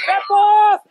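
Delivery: loud shout from the sideline; real coaching shout; shouted call. Real, not acted. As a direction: holler it out to the players. Coach shouting during play.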